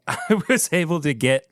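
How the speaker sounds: ordinary delivery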